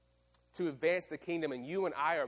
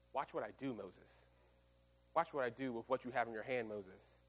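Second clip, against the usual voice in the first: soft, low reassurance; God reassuring Moses softly; tender God voice; 4 semitones lower, 6 dB quieter than usual. Acted. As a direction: draw him in, gently.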